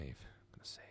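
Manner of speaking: hushed whisper. Real, not acted. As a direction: whisper it to yourself. Whispered aside to self.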